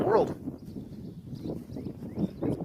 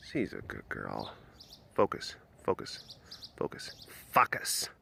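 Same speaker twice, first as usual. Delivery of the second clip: much softer, whispered "Focus"; whispered coaxing; 11 dB quieter than usual. Real, not acted. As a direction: hush it to the cat. Coaching the cat quietly.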